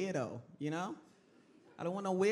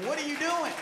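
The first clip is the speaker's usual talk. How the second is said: exasperated shout; 8 semitones higher, 5 dB louder than usual. Acted. Scolding son over his habits.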